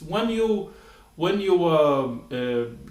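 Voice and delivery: usual speaking voice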